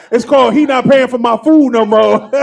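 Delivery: words broken by real laughter; giggling through the joke; laughing while talking. Real, not acted. Host laughing at own joke.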